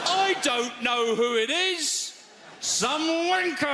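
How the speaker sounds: high, slow mock yell from upstairs; shouted reply. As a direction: call down lazily, hammed up.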